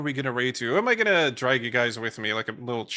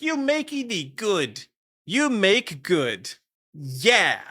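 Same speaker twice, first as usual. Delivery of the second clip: slow, high, goofy drawn-out voice; mock tongue-tied praise; 8 semitones higher, 4 dB louder, much slower than usual. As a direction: play a tongue-tied simpleton, stretching every word. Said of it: "I almost stuttered there, and I was like".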